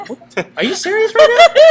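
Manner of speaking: high, loud, fast retold disbelief; hammed-up appalled question; mock incredulity. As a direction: imitate an affronted customer. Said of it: "she looked at me like".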